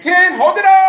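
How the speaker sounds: high, loud call with a long-held "up". Real, not acted. Coach urges class to stay on their heels, high sustained shout. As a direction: shout it high and stretch the last word. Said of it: "when my voice goes higher".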